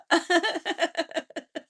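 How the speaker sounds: high, long peal of laughter; spontaneous high cackling laugh; real laugh. Real, not acted. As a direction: laugh hard in shared recognition. Amused by chat replies.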